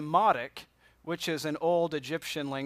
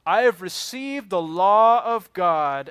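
king's proclamation; 2 semitones higher, 9 dB louder, slower than usual. Retold or imitated. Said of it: "So what he said is".

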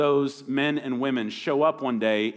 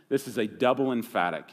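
ordinary delivery

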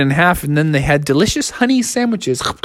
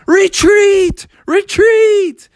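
shouted retreat; 4 dB louder, much slower than usual. Acted. Bears flee shouting.

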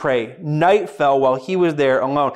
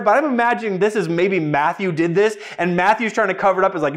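normal talking voice

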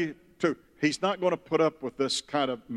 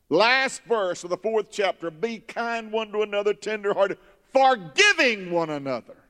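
raised, emphatic scripture declamation; preached scripture; 6 semitones higher than usual. Real, not acted. Preacher reading the last verse to the church, emphatic declamation. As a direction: preach the verse out, pitch lifted high.

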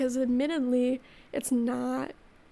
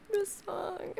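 crying; 6 semitones higher, 4 dB quieter than usual. Real, not acted. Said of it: "I can't stop crying".

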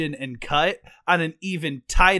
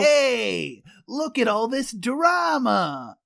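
slow, shouted East Coast catchphrase, pitched up; East Coast accent; 7 semitones higher, 4 dB louder, much slower than usual. Acted. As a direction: ham up a thick East Coast guy, yelling. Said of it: "obsession with East Coast accents".

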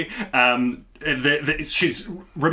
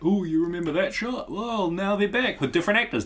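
mocking fan voice; 5 semitones higher, 4 dB quieter than usual.

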